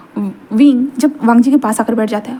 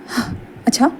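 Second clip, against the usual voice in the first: whispered taunt; 7 dB quieter than usual. Acted. Wangji pins Wing to door.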